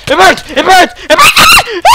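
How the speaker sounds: loud, high yells climbing to a shriek; hammed-up agony. Gamer whose character is on fire, mock agonised screaming. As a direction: escalate from yell to squeal as if burning.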